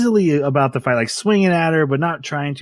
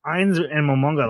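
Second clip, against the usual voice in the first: ordinary talk, nothing marked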